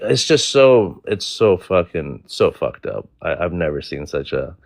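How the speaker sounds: usual speaking voice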